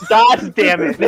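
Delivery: startled curse, half laughing; scared and angry. Real, not acted. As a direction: yelp the curse in alarm. Friend shoved onto him.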